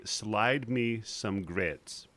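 slow, low model line; slow prompt. Shown as a demonstration. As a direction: spell it out slowly for someone to repeat.

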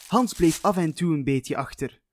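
plain reading voice

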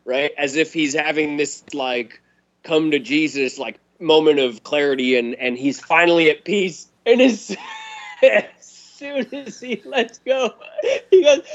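ordinary talk

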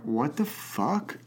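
slow, soft disbelief; stunned. Real, not acted. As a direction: barely get it out in shock. Shocked reaction to murder question.

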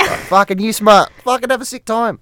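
very high mock Aussie; Australian accent. Answering a mate's g'day, high-pitched Australian accent. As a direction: squeal it in broad Australian. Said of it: "my best accent's my Australian".

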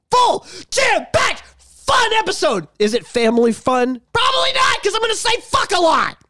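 hyped-up shout. Acted. Hyped-up episode promo.

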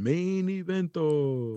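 slow, high announcer drawl; ring announcer hype. Pretend. Host hypes the main event like a ring announcer.

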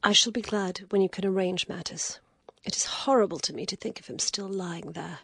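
an exhausted lady, reluctant; weary lady. Acted. Lady speaks.

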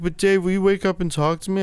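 high pleading whine; crying cellmate pleading with Dave to stay awake; whiny cellmate. Retold or imitated. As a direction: beg like a needy child. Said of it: "He's like".

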